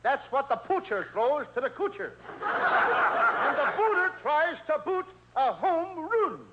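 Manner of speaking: "poocher" and "coocher" for pitcher and catcher, mocking; mock affected vowels. Costello explaining baseball in the lady's vowels, mocking. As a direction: climb high and drawn-out toward "home run".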